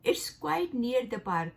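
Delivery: "It's quite near the park" read slowly, word by word; teacher repeating the example slowly; slow example sentence. Shown as a demonstration. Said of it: "तो हम बोलेंगे".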